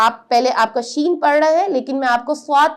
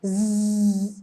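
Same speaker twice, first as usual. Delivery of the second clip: ز buzzed softly like a passing plane; buzzing z sound; 4 semitones lower, 5 dB quieter than usual. Shown as a demonstration. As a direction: hum ز through the teeth. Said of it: "جیسے کہ کوئی آواز جب جہاز گزرتا ہے".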